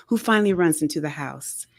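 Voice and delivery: usual speaking voice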